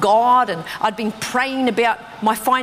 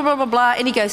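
ordinary delivery

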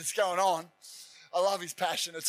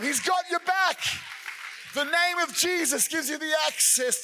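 high, loud preaching shout; full-force preacher's command; fiery preaching shout; 5 semitones higher, 3 dB louder than usual. Acted. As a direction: thunder the rebuke as God.

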